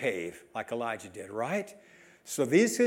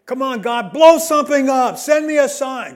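loud, higher, pushy plea; mock demanding prayer; 7 semitones higher, 10 dB louder than usual. Preacher mocking people begging God for a sign, loud mock demand. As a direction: demand fireworks from heaven, hammed-up.

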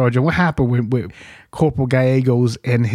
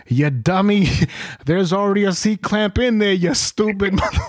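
mock scolding; 7 semitones higher than usual. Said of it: "he goes".

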